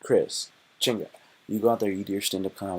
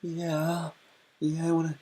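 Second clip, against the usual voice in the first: put-on much higher, slow, sing-song girl voice; girly voice; 7 semitones higher, slower than usual. Streamer acting the girl insisting to the guy, high girly voice. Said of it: "And she goes".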